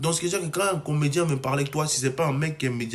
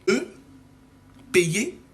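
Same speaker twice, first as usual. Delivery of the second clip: ordinary talk, nothing marked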